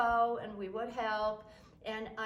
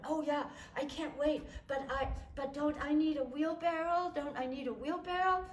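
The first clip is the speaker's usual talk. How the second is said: put-on little girl voice; 5 semitones higher than usual. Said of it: "And I went".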